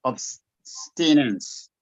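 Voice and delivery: "Abstinence" slowly, ending in "nans"; corrective pronunciation. Shown as a demonstration. Teacher corrects student's obstinate, corrective pronunciation. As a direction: model "Abstinence" in stretched syllables, AB-sti-nans. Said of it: "Say abstinence".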